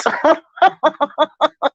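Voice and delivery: high giggle; laugh. Real, not acted. Laughing with her chat about phone in the bathroom, high giggle. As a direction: burst out laughing.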